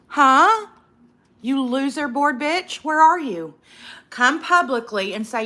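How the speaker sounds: loud, high, furious demand; angry call-out. Real, not acted. To the anonymous haters on TikTok, a loud angry challenge. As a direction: shout it out, daring them to show up.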